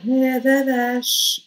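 mother calming her newborn; mock soothing. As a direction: soothe a screaming baby.

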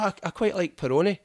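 chirpy confident answer; own past self. Retold or imitated. Telling Italians his beer choice, high and eager. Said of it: "So I was like".

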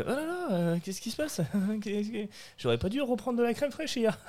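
high, squeaky worried voice; hammed-up stomach panic; mock panic. His own panic in class.

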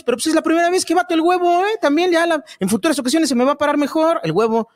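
indignant taquero making excuses; defensive taco seller. Acted. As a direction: plead your case as an amateur taquero.

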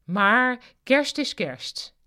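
high, loud, drawn-out declaration; loud exclamation. A narrator's reading. Marja chatting to Kavia at Christmas drinks, exclaiming. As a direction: proclaim it with slow, swelling emphasis.

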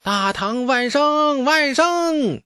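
high, drawn-out battle cry; roaring army salute. A narrator's reading. Soldiers hailing the Tang outside the gate, high drawn-out roar. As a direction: chant it high and long like massed troops. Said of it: "暴喝出声".

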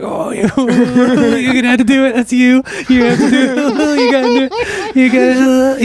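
high, laughing taunt; laughing teasing. Retold or imitated. Teasing Sean about the driver, laughing high. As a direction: egg your friend on through laughter. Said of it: "you know, we're dying".

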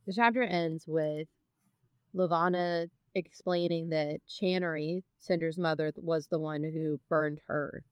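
ordinary delivery

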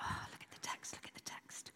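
whispered to herself in wonder; hushed, amazed whisper; awed whisper. Retold or imitated. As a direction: breathe it out like a realisation. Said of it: "I was like".

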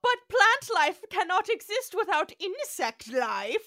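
high, loud know-it-all correction; pedantic researcher. Retold or imitated. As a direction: correct them like a smug nerd. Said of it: "and he went".

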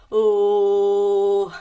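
loud open warm-up "Oh" with a low pitch; loud vocal warm-up. Shown as a demonstration. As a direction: let a big low "Oh" out from the chest. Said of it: "vas a hacer así". (un "Oh" fuerte y grave para calentar la voz)

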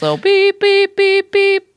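high-pitched beep, beep, beep; playful high spoken beeps; imitated reversing beeps. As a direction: beep like a truck backing up. Imitating a vehicle backing up.

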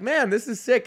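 high, bright-eyed thrill in his voice; excited. Retold or imitated. Telling listeners about the gig, high and thrilled. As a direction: sound thrilled at the news.

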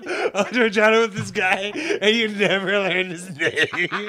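high, loud, laughing disbelief; helpless laughter through the words; real laughing. Real, not acted. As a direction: crack up while recapping it. Laughing at the friend's story.

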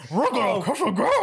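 high, goofy cartoon dog-and-pal voice; hammed-up cartoon sidekick; Shaggy and Scooby. Film fan playing the clerks for laughs, goofy cartoon voice. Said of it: "why are the clerks now Shaggy and Scooby".

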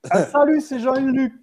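hammed-up cheesy radio host; podcast host intro. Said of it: "le podcast en mode".

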